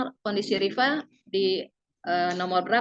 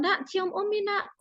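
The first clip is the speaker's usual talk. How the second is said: put-on higher, sing-song coaxing call to a child; sweet coaxing call; 11 semitones higher, slower than usual. Mother coaxing her son for a kiss before school, higher sweet call. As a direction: coax the boy into a goodbye kiss.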